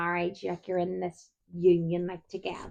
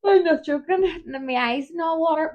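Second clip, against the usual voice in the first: laughing; 8 semitones higher, 6 dB louder than usual. Real, not acted. Yawning and laughing, eyes watering.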